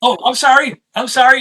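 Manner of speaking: high, loud, flustered sorry; panicked apology. Retold or imitated. Startled musician apologizing to [name], high and panicked. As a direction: blurt it out, suddenly mortified.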